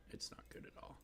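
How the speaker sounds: a real disappointed whisper; whispered grumble about the bad return rate. Real, not acted. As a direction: breathe it out under your breath. Sighs over bad returns.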